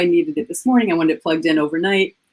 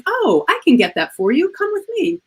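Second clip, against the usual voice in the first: higher, bright helpful store clerk voice; bubbly salesgirl; 11 semitones higher than usual. Retold or imitated. Best Buy clerk greeting an upset customer, higher bubbly helpfulness. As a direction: lift it up like a cheerful helper. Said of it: "She's like".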